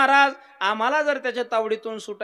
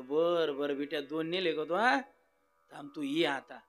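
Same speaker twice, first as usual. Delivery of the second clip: menacing cat; 6 semitones lower, 7 dB quieter than usual. Acted. Cat threatening softly.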